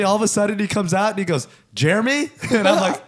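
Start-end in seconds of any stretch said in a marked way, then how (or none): 1.7-2.3 higher, questioning call-out; calling a name. Retold or imitated. Desk clerk calls out to the waiting room, calling a name. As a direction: imitate a clerk calling for a customer.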